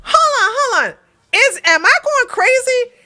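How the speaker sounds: high, loud, incredulous questioning; stunned disbelief. Real, not acted. Streamer realizing the wrong word was used, stunned disbelief. Shocked at learning the right word.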